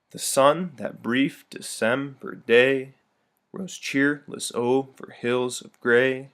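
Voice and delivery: slow, every second syllable stressed; iambic reading. Shown as a demonstration. Teacher reads poem aloud stressing iambic rhythm. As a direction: read with a steady da-DUM beat. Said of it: "this first one I'll read first".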